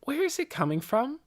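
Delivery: put-on high-pitched child's voice; puzzled girl. A narrator's reading. Girl noticing music in the house, high and puzzled. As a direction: say it high and curious.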